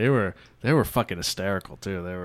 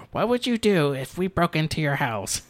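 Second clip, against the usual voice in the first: high, raised impression voice; imitated TikTok intruder; 8 semitones higher, 4 dB louder than usual. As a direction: put on a high voice bursting in on someone. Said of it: "bursts through the door. It's like".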